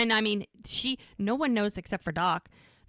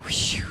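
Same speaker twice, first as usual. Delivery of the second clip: imitated blown-out sigh of relief; acted exhale of relief; relieved whew. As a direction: blow out a mock relieved breath. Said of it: "And he's just like".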